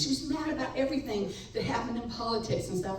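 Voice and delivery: ordinary talk, nothing marked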